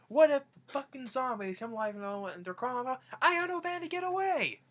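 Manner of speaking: high-pitched mock panic rant; imitating Grandpa. Grandpa fretting about a zombie attack, mock-panicked yell. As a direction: act out a worked-up rant, climbing to a high yell. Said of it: "Grandpa was talking about zombies".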